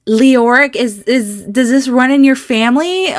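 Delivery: high, loud amazed exclamations; astonished. Real, not acted. To Leoric about the surprise twins, loud and astonished. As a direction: burst out in disbelief.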